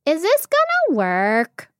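high, slow little monkey voice; doubtful monkey. A narrator's reading. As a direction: voice a small monkey unsure of the plan. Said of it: "whispered one monkey, peering doubtfully".

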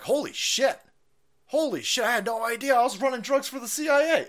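high, stunned exclamation in a put-on pilot voice; shocked pilot. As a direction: play a pilot who just realised he's been running drugs. Pilots discovering they ran CIA drugs.

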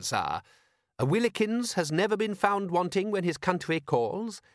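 lightly voiced proper butler; a hint of stiff, eager manservant; prim butler voice. Butler character voice.

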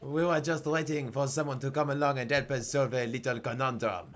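put-on comic French-accented goblin. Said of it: "least racist accent I could do".

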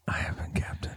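hushed ASMR sign-off; ASMR whisper. Pretend. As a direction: whisper each name softly. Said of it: "you were gonna do the A S M R".

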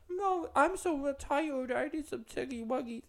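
put-on high, very slow babyish whimper; mock baby voice. Mocking a grown woman acting sleepy and babyish. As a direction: drag it out in cutesy baby talk. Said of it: "why are you like".

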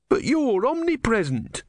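high-pitched protest; indignant. A narrator's reading. Disciple protesting to his god, high-pitched indignation.

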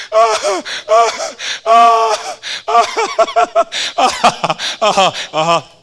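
breathless ah ah laughter; winded laughing; laughing gasps. Real, not acted. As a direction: gasp through the laughter. Laughing fit continues.

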